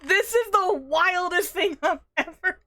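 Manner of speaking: very high, loud wail of disbelief; real hysterical disbelief at full volume; overwhelmed shrieking. Real, not acted. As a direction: wail it, climbing into a squeal.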